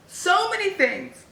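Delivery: high, drawn-out wail of overwhelm; overwhelmed outcry. Real, not acted. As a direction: cry out in high-pitched exasperation at it all. Overwhelmed by the book's events.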